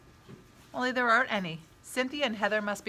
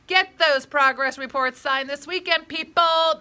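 loud, raised teacher's call, climbing on the last word; 5 semitones higher, 7 dB louder than usual. A narrator's reading. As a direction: call out to a whole noisy class. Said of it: "Miss Sanchez calls out".

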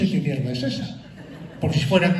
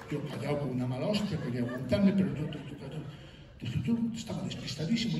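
soft, stumbling aside; subdued seething; 4 semitones lower, 11 dB quieter than usual. Acted. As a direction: drop the voice, swallowing your anger. Recalls fuming silently, at a loss.

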